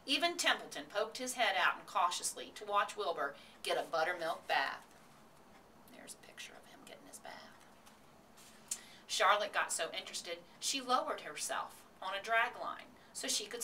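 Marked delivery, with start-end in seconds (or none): 5.9-7.5 hushed, whispered note to the listener; very soft whisper; whispered aside. Real, not acted. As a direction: step out of the story and whisper. Aside to listeners showing a picture.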